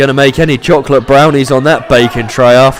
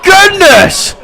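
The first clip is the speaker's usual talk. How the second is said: excited shout; 4 dB louder, much slower than usual. Real, not acted. Big finishing move lands.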